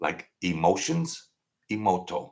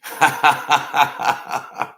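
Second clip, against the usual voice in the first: big cackling laugh at own joke; loud laugh; 7 semitones higher, 6 dB louder than usual. Real, not acted. Streamer laughing at his own age joke. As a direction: burst out laughing.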